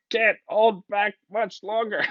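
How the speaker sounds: high, drawn-out mock strain; playfully bursting, slow and high-pitched. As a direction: stretch it out high, as if about to burst. Copy acts out losing self-control.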